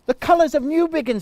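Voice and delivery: high, loud, quick gasp of delight; excited discovery. A narrator's reading. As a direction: burst out with joy at the find. Boy finds the box.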